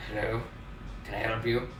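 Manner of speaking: a hint of a low, mysterious phone greeting; lightly voiced sultry doorkeeper; dark smoky voice. Bartender answering the speakeasy phone, low and mysterious. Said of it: "a dark, smoky, mysterious voice".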